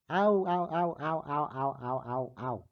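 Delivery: sore-throat yelps that die away; pained ows. Real, not acted. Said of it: "My throat is on fire".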